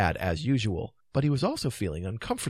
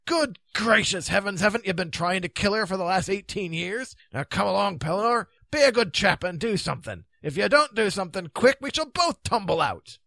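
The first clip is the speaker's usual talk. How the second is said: raised, impatient knight's voice; high, blustering character voice; Grummore, exasperated; 9 semitones higher, 3 dB louder than usual. Acted. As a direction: urge him crossly, voice pitched up.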